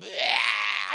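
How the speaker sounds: low shouted ah in imitation of screaming kids; short imitated shriek of frightened children; mock kids' scream. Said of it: "They started screaming".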